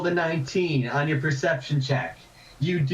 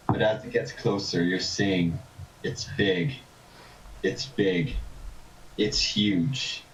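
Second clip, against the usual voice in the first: game master narration; 5 semitones lower than usual. A narrator's reading. GM describes vision.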